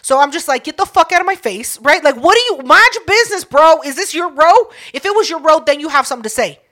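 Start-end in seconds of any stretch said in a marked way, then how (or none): 0.6-1.8 mock-indignant, higher brush-off; annoyed retort. Concertgoer telling a nosy stranger off, annoyed retort. 2.0-6.6 raised, louder retelling of telling someone off; indignant retort. Retold or imitated. Concertgoer to man meddling about her seat, indignant retort. Acted quarrel over a seat.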